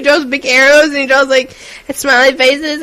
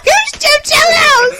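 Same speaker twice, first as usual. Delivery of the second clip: confused shout; slower than usual. Retold or imitated.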